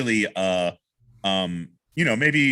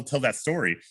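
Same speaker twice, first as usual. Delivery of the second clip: ordinary talk, nothing marked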